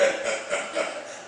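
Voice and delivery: quiet breathy chuckle; soft chuckle. Acted. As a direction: chuckle under your breath. Chief chuckles.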